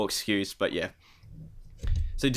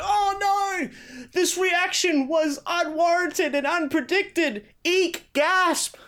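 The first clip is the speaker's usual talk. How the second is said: much higher, hammed-up shocked whine; mock shocked developer; slower than usual. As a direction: play the offended dev, over the top. Mocking devs' shocked reaction.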